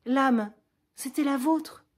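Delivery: high and loud, pressing him; unmasking him with overwhelming emotion; impassioned accusation. Acted. Roxane pressing Cyrano with the truth, high and loud.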